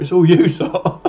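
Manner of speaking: laughing as he shifts the blame. Real, not acted. Streamer blaming the viewers for his accent, laughing. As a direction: break into a laugh mid-sentence. Giggling after doing the accent.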